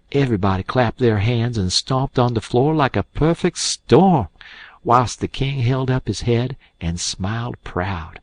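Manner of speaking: ordinary delivery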